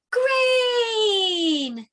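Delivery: yelling. Pretend. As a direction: gasp then loud high yell. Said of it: "I want you to yell out the answer".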